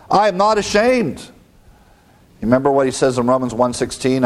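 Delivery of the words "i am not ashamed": high, ringing "not ashamed"; raised pitch, proud and resolute; emphatic declaration. Acted. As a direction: declare it as Paul standing his ground. Acting out Paul's defiant declaration.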